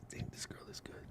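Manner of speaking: hushed whisper to the audience; whispered aside. Real, not acted. Whispering to viewers about the producer's skill, hushed. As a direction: lean in and whisper the praise.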